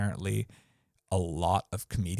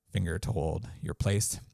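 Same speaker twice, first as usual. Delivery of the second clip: usual speaking voice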